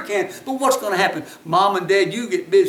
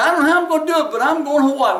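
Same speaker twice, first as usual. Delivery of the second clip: higher, emphatic retold insistence; determined; 6 semitones higher, 4 dB louder, faster than usual. Paralyzed woman insists she will get to Hawaii, determined. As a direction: lift the voice, brush off every doubt.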